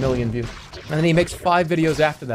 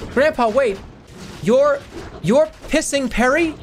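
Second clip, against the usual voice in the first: YouTuber voice; 9 semitones higher, slower than usual. Acted. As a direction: gush like an excited YouTuber. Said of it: "The grandson has the YouTuber voice".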